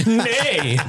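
high, giggling horsey nay; laughing horse neigh. Pretend. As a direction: answer like a horse. Horse pun answer.

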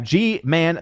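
normal talking voice

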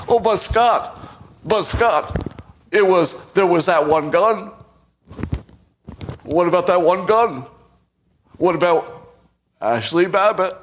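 high, loud, whiny mock of his critics; mocking Trump impression. Mocking his critics, a high whiny Trump-style impression. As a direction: sneer it out like a petulant critic. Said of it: "I'm gonna do a Trump impression".

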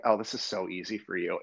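normal talking voice

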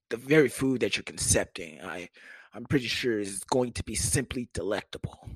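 low, stiff, over-formal courtesy; measured deep voice with pompous politeness; deepened voice. Acted. Boyfriend impressing girlfriend's mama, deep formal voice. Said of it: "I tend to deepen my voice".

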